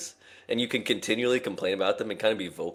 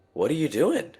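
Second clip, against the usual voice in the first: high, sharp mock supervisor bark; imitating a stern supervisor, pitched up; 8 semitones higher than usual. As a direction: play the snippy boss catching you out. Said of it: "said it in, like, this way".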